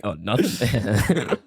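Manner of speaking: slow, laughing fake innocence; fake innocent. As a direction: drawl it like you're hiding something. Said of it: "we'd be like".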